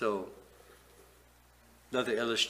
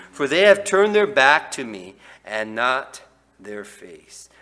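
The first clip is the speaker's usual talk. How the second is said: preaching scripture; 11 dB louder than usual. Acted. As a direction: thunder the verses at the people. Reading scripture with force.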